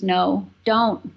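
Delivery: ordinary talk, nothing marked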